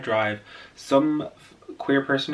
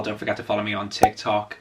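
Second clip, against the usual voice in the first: ordinary delivery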